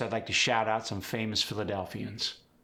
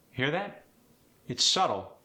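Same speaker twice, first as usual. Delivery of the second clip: ordinary talk, nothing marked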